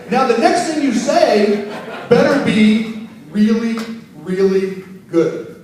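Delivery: drawn out for emphasis; slow emphatic stress. Real, not acted. Teaching DJs, slowed and pointed. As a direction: stretch out "really, really good". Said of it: "slowed emphasis".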